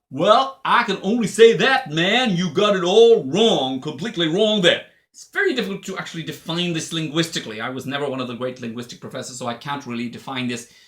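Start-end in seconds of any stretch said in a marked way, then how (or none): 0.1-4.9 put-on higher, louder Southern accent, man drawn out slow; hammed-up American Southern accent. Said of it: "a type of Southern accent".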